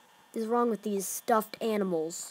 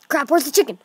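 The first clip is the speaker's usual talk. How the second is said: louder frantic question; panicked ask; 4 semitones higher, 9 dB louder, much faster than usual. Real, not acted. Gamer frantically looking for the chicken. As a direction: blurt it out.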